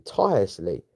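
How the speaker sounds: ordinary delivery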